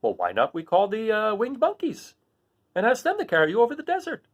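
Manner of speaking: straw man with a bright idea; Scarecrow. Acted. Scarecrow proposing the winged monkeys. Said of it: "Finally, he said".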